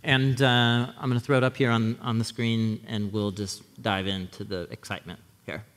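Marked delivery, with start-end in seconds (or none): none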